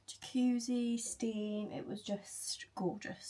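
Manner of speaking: slow, dreamy whisper; breathy whisper. Real, not acted. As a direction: sigh it out softly, savouring. Dreamy memory of the sauna.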